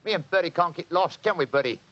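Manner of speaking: Cockney disguise. Acted. Disguised Holmes fibs to the landlady, Cockney accent. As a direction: bluff the landlady as a Cockney council man. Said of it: "My Cockney accent".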